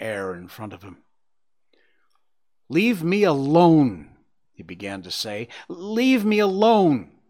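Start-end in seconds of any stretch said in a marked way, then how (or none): 2.7-4.2 high, loud, drawn-out plea; stretched-out begging cry; imploring. A narrator's reading. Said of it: "quietly and imploringly". 5.7-7.2 high, slow, wailing plea; desperate drawn-out entreaty; imploring. A narrator's reading. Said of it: "quietly and imploringly".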